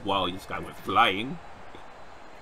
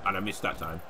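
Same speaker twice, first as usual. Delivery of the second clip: ordinary talk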